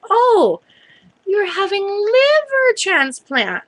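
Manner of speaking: high-pitched, drawn-out mock realization; imitated lab technician. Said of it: "there was definitely a language barrier".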